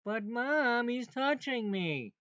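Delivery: put-on high whiny kid complaining to mom; whiny tattling little sibling. Child voiced tattling to mom.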